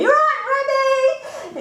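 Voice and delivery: mocking call down the corridor, pitched high; kids shouting. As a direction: jeer at her like a schoolkid. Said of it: "they'd be shouting after me".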